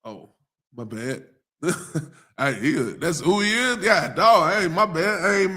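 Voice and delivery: ordinary talk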